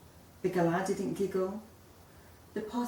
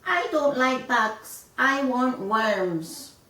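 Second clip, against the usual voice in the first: high, loud, slow whine; whinging chick; 5 semitones higher, 8 dB louder, slower than usual. A narrator's reading. Chick demanding worms from parents, whinging. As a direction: drawl the complaint like a spoilt baby. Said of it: "when he whinged".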